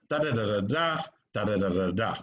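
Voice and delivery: usual speaking voice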